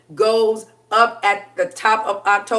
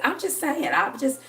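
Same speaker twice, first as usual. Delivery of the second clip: soft, teasing, much quieter; hushed playful come-on; coy flirty murmur; 7 dB quieter than usual. Shown as a demonstration.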